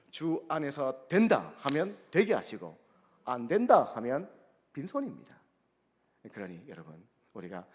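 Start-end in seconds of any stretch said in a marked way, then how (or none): 0.1-5.4 emphatic preaching. Acted. (믿음의 말을 강조하는 설교, 힘있고 느리게)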